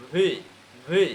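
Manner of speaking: ভি with air let out at the start; aspirated "bhi" for ভি; airy V. Shown as a demonstration. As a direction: let breath escape on the first sound of each ভি. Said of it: "ভি কখন থেকে বাতাস বের হবে".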